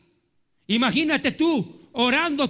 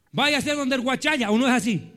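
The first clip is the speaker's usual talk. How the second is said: ordinary talk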